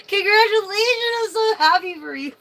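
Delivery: high, loud, overjoyed cheer; excited congratulations. Real, not acted. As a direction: cheer for them at full voice. Congratulating the viewer.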